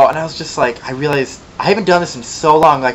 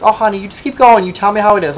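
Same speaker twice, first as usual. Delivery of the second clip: sarcastic sweetness; 8 semitones higher than usual. Retold or imitated. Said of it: "and I was like".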